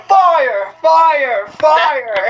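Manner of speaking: high, drawn-out yells of a gamer; mock screaming. Gamer on the mic in party chat, mock screaming. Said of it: "while you're screaming".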